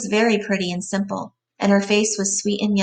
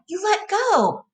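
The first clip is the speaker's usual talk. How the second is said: high, urgent cry; 12 semitones higher, slower than usual. A narrator's reading. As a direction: demand it in panic. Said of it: "she cried".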